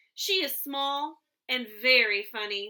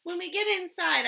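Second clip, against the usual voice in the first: ordinary delivery, nothing marked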